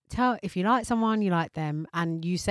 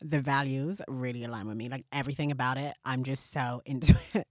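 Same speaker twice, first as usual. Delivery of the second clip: quick, softer, lower gushing; gushing applicant; 7 semitones lower, 5 dB quieter than usual. Retold or imitated. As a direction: gush like an eager job applicant. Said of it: "It's like".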